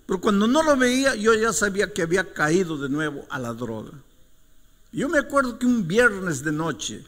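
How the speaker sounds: high, loud preacher delivery; impassioned pulpit testimony; full-force preaching. Acted.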